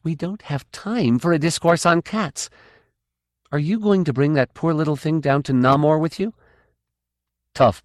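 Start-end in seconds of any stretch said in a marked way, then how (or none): 0.0-6.3 raised, irritated question; irritable. Acted. Kay cutting off Tough's cat talk, irritated. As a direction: push him to get on with it. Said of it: "said Kafira Kay irritably".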